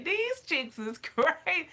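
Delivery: high, laughing through the words; giggling at crazy videos. Real, not acted. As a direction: crack up while you say it. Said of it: "I just be laughing".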